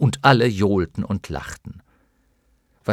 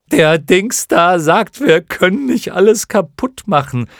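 clown moth announcing; 8 semitones higher, 9 dB louder than usual. A narrator's reading. First clown moth tells the others, announcing loudly. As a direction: announce it loudly like a silly little creature. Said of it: "teilte die erste Clownmotte den anderen mit".

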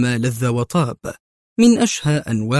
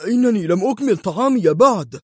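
high, whiny protest; sulky patient losing his food; petulant whine; grumbling complaint; 9 semitones higher than usual. A narrator's reading. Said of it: "يهتف متبرما".

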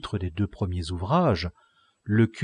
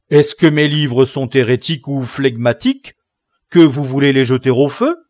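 a touch louder, offended host; mild indignant innkeeper; 6 semitones higher, 13 dB louder than usual. A narrator's reading. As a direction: protest the burning, understated. Innkeeper protests.

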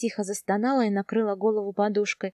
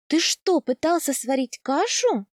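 higher and louder, caught off guard; surprised; 4 semitones higher, 3 dB louder than usual. Acted. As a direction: gasp it out at the burnt pot. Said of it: "удивлённо спросила я мужа".

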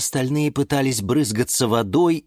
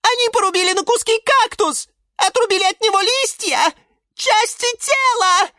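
very high-pitched horrified squeal; Наталка shrieking; 4 dB louder than usual. A narrator's reading. Violet watching a cactus being chopped, shrieking. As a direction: scream it in tiny-voiced horror. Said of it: "вопила фиалка Наталка".

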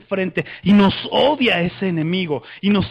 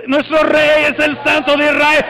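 high, loud rallying cry; grito de guerra; 5 semitones higher, 6 dB louder than usual. Shown as a demonstration. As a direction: shout it to lead the troops. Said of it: "después de gritar". (grito de guerra alto y fuerte)

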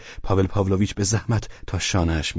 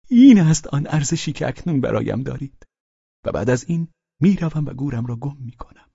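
higher, emphatic declaration; fervent drunkard; 8 semitones higher, 4 dB louder than usual. Acted. Drunken guest after kissing host's hand, fervent.

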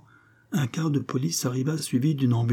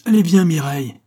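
angry command; 8 semitones higher, 9 dB louder than usual. Acted. Father furious, calls wife.

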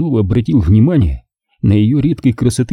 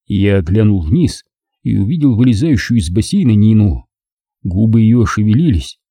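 ordinary delivery, nothing marked

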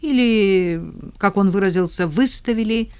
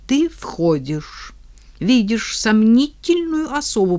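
ordinary delivery

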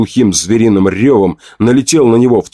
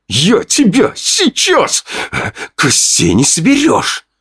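high, slow, strained menace; fighting threat; 11 semitones higher, slower than usual. Acted. Fedya threatens the narrator mid-brawl with slow, strained menace. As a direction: threaten through the struggle.

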